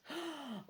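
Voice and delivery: sharp mock gasp. Reader reacting to the boat find, gasping. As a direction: suck in a quick breath.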